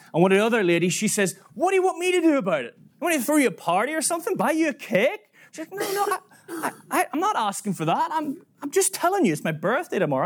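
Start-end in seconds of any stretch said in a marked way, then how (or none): 1.5-5.2 high-pitched, rapid mock indignation; high voice, scornful questions; snarky woman's retort. Mocking retort from another woman. 5.7-10.2 high-pitched, hurt protest; pitched-up, stumbling denial; defensive woman. Retold or imitated.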